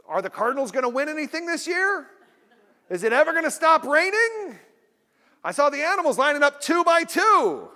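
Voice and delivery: high, loud chatty stranger griping; small-talk stranger. Retold or imitated. Stranger griping to fellow riders, high and loud. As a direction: whine like a bored elevator stranger. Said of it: "somebody inevitably says".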